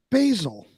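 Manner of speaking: "basil" said the American way, BAY-zil; "basil" as BAY-zul, stressed on the first syllable; correcting pronunciation. Shown as a demonstration. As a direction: give "basil" a long A, as in bay. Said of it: "It's basil".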